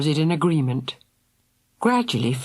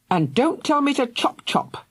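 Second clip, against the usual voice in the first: higher, sharp angry snapping; angry outburst; 11 semitones higher than usual. A narrator's reading. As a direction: lash out at your sister. Angry brother quarrels.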